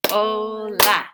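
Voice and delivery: "o - la", a clap on each; two-clap "Hola" for the class; clapped syllables. Shown as a demonstration. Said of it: "How many syllables in hola?".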